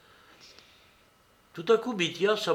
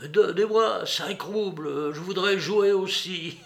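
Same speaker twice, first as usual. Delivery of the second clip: lowered-voice request; 5 semitones higher than usual. A narrator's reading. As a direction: ask a small favour with a little giggle at the end. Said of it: "lui dit tout bas".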